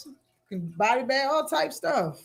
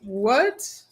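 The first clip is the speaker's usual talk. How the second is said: ordinary talk, nothing marked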